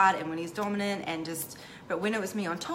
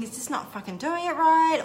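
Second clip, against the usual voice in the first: anxious inner thought; 7 semitones higher, 6 dB louder than usual. Retold or imitated. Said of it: "I'd be all up in my head, like, thinking".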